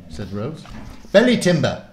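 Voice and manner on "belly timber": loud, higher, impatient warlock. Acted. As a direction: snap it back at her slowness. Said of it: "Murden repeated impatiently".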